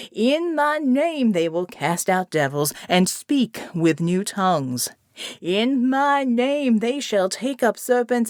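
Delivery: slow, rhythmic revival sermon; preaching. Acted. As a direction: proclaim it to the flock, building. Pastor preaching scripture.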